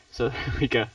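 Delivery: usual speaking voice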